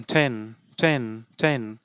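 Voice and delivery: টেন said with a short open e like "ten"; teacher modelling টেন; pronunciation demo. Shown as a demonstration. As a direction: say টেন slowly, vowel as in "bed". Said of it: "ই আর ই থাকছে না, এ হয়ে যাচ্ছে".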